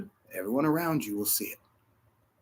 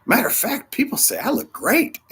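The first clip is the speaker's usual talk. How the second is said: louder and much higher, climbing as it goes; retelling a cheerful over-the-top reply to a friend; overexcited boast; 9 semitones higher, 6 dB louder than usual. Retold or imitated. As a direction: brag that you feel great, pitch rising with each phrase. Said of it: "I said".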